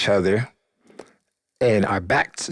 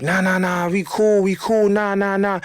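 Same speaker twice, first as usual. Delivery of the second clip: hasty placating; 9 semitones higher than usual. Retold or imitated. Homeboy hastily denies any trouble.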